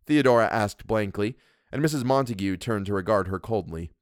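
ordinary delivery, nothing marked

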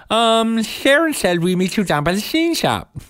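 high voice, every s slurred into sh; jolly man with a heavy lisp. Retold or imitated. Theater man answering directions, high and lisping. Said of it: "there couldn't have been more S's".

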